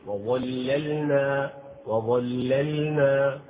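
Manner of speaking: وظللنا very slowly, second lam held and clearly sakin; careful slow sukun. Shown as a demonstration. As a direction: draw out وظللنا, showing the silent lam. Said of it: "يجب أن تظهر اللام الساكنة الثانية".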